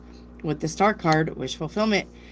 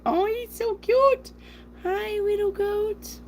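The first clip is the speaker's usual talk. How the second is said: much higher, slow cooing voice. Real, not acted. Cooing at cute goat image.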